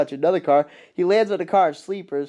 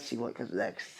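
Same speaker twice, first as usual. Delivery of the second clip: whisper; 4 semitones lower, 16 dB quieter than usual. Real, not acted. Thinking aloud, whispered aside.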